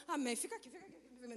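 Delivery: hushed mock shooing. Mock hushing the visitor away.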